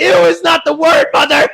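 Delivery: loud, high shout at mother; shouted scold. Retold or imitated. Daughter yelling at mom for saying ew. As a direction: yell it at her in disbelief.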